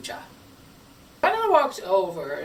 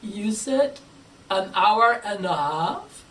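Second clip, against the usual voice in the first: louder, slow disbelieving question; incredulous organizer; 5 dB louder, slower than usual. Retold or imitated. Organizer asking the speaker in disbelief, louder and slow. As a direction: repeat it back in disbelief. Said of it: "They looked at me like this".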